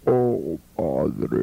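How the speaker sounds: very low, hollow, ghostly prayer; mock sepulchral prayer voice; voice from beyond the grave. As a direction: intone it like a voice from the tomb. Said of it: "Como voz de ultratumba".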